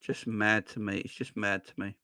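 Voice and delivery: soft, low, weary disbelief; quiet disbelief. Real, not acted. Vlogger trails off, quiet and deflated at the fans. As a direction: let it fade out, deflated.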